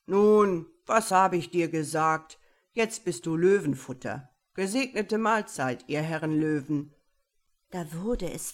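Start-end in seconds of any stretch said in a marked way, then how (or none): 0.1-6.9 laut hinabrufender, höhnischer Bär; spottender Bär. Acted. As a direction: von oben in die Grube spotten. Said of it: "Dann rief er hinab". (lauter, höhnischer Bärenruf)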